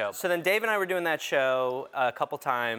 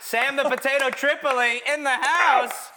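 slow, loud hype intro; hype announcer; 8 semitones higher, 5 dB louder than usual. Acted. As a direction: call him out like an MC. Hypes Sam like an announcer.

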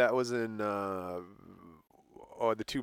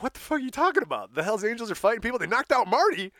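high, fast, panicked report; acted backstage alarm, pitched way up; alarmed outburst; 10 semitones higher, 4 dB louder than usual. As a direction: blurt the bad news in disbelief.

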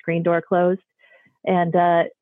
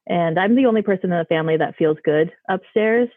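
ordinary talk, nothing marked

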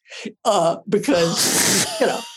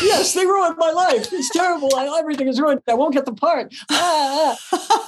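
hammed-up wailing despair, much higher; mock meltdown; 8 semitones higher than usual. Actress backstage hamming up her ruined-life wail. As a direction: wail as if life is over, played for laughs. Retelling meltdown over broken light.

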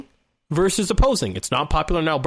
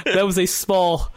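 ordinary talk

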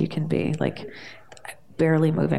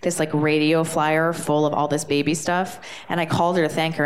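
ordinary talk, nothing marked

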